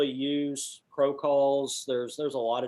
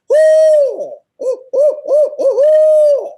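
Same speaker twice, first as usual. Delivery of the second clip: loud, very high owl hoot; imitated owl hoot; 16 dB louder, much slower than usual. Mentor turns round to call the turkey, loud owl hooting. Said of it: "He turns around and goes".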